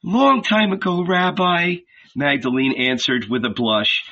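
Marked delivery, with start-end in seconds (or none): 0.0-1.8 higher, shy and blushing woman; Magdalene's voice. A narrator's reading. Magdalene answers the rabbi, blushing and shy.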